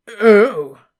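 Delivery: a sudden loud Oh swallowing a belch; suppressed belch. Acted. Slughorn stifling a belch as he answers Harry. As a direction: gulp back a belch. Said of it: "repressing a large belch".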